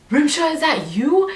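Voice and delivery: high, surprised call of her name; a guy spotting an old friend, startled. Retold or imitated. Guy in the food line spotting her, high and surprised.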